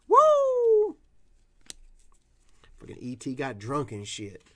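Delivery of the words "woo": high, loud whoop; excited whoop. Real, not acted. Whooping at his own joke with friends. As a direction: throw your head back and cheer.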